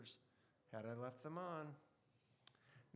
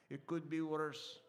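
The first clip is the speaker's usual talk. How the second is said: put-on Polish accent; 7 dB louder than usual. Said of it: "in his Polish accent".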